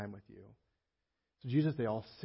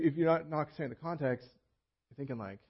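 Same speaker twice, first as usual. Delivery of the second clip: ordinary talk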